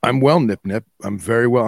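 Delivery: normal talking voice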